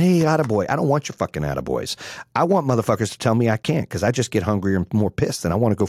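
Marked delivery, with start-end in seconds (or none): 0.0-0.7 mock cheerful attaboy. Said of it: "people saying".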